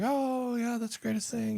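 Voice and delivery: hammed-up delight pitched high; mock enthusiasm. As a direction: pretend to be thrilled, way up high. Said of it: "I'd love to tell you that I was like".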